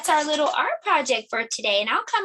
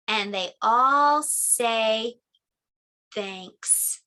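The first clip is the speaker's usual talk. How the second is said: put-on reading to children; 2 semitones lower than usual. A narrator's reading. Teacher reading Bear Says Thanks to the class. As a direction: read it gently to little listeners. Reads picture book to children.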